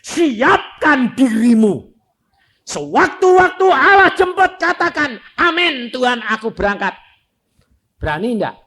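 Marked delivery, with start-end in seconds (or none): 0.0-7.0 high, loud fiery exhortation; preaching at full force. Acted. Preacher urging the congregation to be ready, loud and fervent. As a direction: call the crowd to be ready, full voice.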